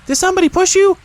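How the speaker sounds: very high little joey voice; bright eager baby kangaroo, way up high; Roo. A narrator's reading.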